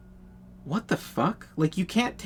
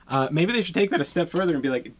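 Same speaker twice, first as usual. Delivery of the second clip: ordinary talk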